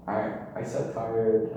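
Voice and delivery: ordinary talk, nothing marked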